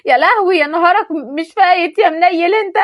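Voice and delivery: howling at the lost phone; shocked yell. Acted. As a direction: yell in dismay as the phone sinks. Phone falls into the Nile.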